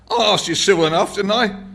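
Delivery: put-on high, loud, cockney old man; pugnacious old man. A narrator's reading. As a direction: bristle at the barman like an indignant old prole. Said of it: "straightening his shoulders pugnaciously".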